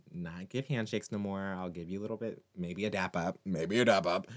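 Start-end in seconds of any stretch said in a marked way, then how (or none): 3.4-4.3 louder, playful repeat with a bit of swagger; playful emphasis. Acted. Pitching a dap to viewers instead of handshakes, playful and louder. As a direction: sell the dap like it's the cool option.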